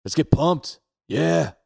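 loud, high hype-up yell; pumped up. Real, not acted. Friend hyping up before a fitness challenge, pumped. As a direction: shout it to fire up the room.

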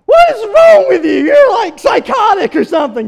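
shouting at the hunters in disbelief; imagined shout. Pretend. Preacher imagines yelling at the hunters, loud shout. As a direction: let it fly, high and loud. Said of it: "a part of me wanted to say".

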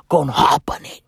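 hushed conspiratorial whisper; secretive aside. Real, not acted. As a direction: lean in and whisper it. Conspiratorial aside about South Africans.

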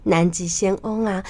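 usual speaking voice

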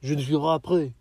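loud, run-together exaggerated French; sounding French. Shown as a demonstration. Learner trying to pass in a French cafe, exaggerated French. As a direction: slur "Je ne suis pas prêt" into one loud French word. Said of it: "I need to be like".

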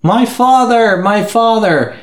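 high, melodramatic lament; hammed-up cry over a fallen father; mock anguish. Boy's father crushed by planes.

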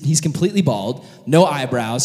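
ordinary delivery

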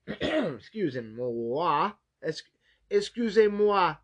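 slow, drawn-out mock Italian accent; bad Italian accent. Joking to listeners in a bad Italian accent. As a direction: stretch out a silly fake Italian accent. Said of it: "that was my Italian accent".

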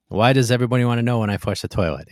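put-on Italian accent, fast and loud; quick loud quip in an Italian accent. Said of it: "in his Italian accent".

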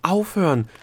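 loud, high desperate shout; desperate cry. A narrator's reading. Delia watches the dwarfs plunge, crying out desperately. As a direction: cry out to make them stop. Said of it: "rief Delia verzweifelt".

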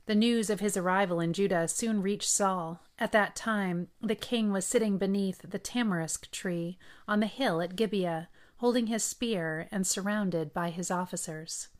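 ordinary delivery, nothing marked

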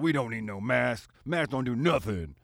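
mock Southern mask-refuser voice; Southern accent. Imagined man talking about masks, in a mock Southern accent. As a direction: put on a broad Southern accent. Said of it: "in a Southern accent".

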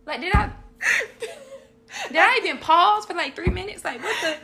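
slow, high and laughing disbelief; mock your own confusion while cracking up; laughing self-mock. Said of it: "you ever say something and be like".

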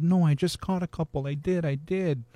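put-on little girl protesting she caught some; lightly voiced child, pleading. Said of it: "And she said".

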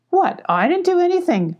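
very high, startled protest; indignant denial. A narrator's reading. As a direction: squeal innocence, pitch shot up. Accused guard denies it.

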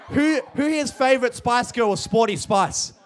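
usual speaking voice

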